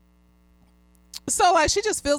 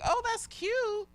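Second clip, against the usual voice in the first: soft, very high, drawn-out fake approval; high, hushed, doubtful sweetness; insincere compliment; 12 semitones higher, 7 dB quieter, slower than usual. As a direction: imitate onlookers politely faking a compliment. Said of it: "but, like, with a frowny face".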